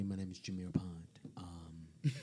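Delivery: soft breathy radio whisper. Real, not acted. Introducing himself on the podcast, in a whisper. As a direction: whisper it like a late-night DJ. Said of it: "I don't know why I'm talking like this".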